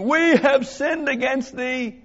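high, slow cry of confession, read aloud; crying out to God. Acted. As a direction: cry it out to the Lord, drawn out. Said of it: "cried unto the Lord".

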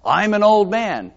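Zechariah's protest. Retold or imitated.

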